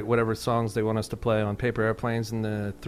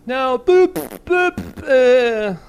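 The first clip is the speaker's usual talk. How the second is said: high goofy boops, much louder; silly high mock voice; 12 semitones higher, 8 dB louder, much slower than usual. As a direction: mimic a cartoonish tone shift. Mocking the film's silly tone.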